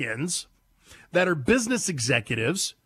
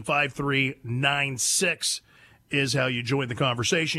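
usual speaking voice

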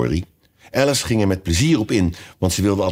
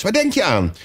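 high, stern demand; 12 semitones higher than usual. A narrator's reading. Mouse questioning inattentive Alice, stern. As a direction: challenge the daydreamer, pitched high. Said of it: "zei de muis streng tot Alice".

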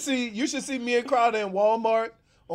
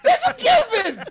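high, exasperated laughing rant; incredulous comic outburst; 10 semitones higher than usual. Real, not acted. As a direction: squeal it in disbelief through laughter.

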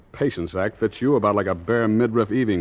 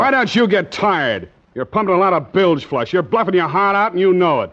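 defiant retort; 7 semitones higher, 3 dB louder than usual. Acted. Connie snaps back under interrogation.

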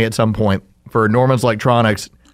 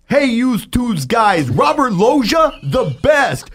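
Italian guy prank caller; 7 semitones higher, slower than usual. Retold or imitated. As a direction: shout it like a brash Italian caller. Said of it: "I call as the first person".

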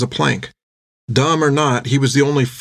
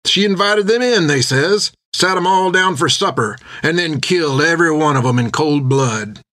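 higher-pitched tavern storyteller; drinker telling a tale; 4 semitones higher than usual. Acted. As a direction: spin the bloody tale with relish. Drinker tells the war woman tale.

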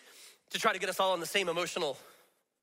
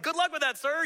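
joking pity; 9 semitones higher than usual. Retold or imitated. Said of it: "And I'm like".

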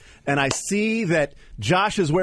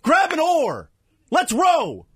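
halftime pep speech; 6 semitones higher than usual. Real, not acted. Rallying fans after the win.

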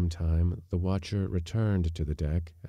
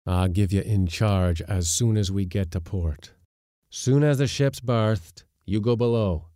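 louder, hard sea captain's threat; stern skipper; 3 semitones higher, 5 dB louder than usual. Acted. Skipper threatens mate with arrest, loud and stern. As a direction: lay down the law to the mate.

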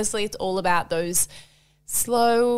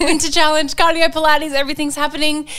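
louder and higher, bursting with real excitement; excited; 6 semitones higher, 6 dB louder than usual. Real, not acted. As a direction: rattle it off thrilled. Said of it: "I'm so excited".

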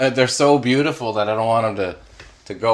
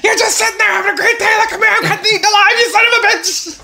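ranting tree; 4 dB louder, much faster than usual. Pretend. Tree raging at homeowner.

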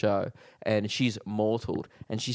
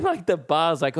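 ordinary delivery

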